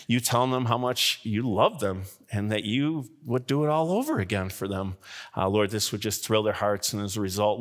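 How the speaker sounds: earnest pastor's prayer, unhurried; closing prayer. Acted. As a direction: pray over them gently and sincerely. Pastor prays over congregation.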